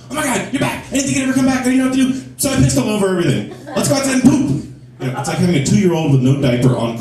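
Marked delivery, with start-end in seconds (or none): 0.1-4.7 very high, frantic dog voice; a dog bursting with joy at master's return; hyper excited dog. Acted. Dog greets owner coming home, wildly excited. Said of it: "He's like".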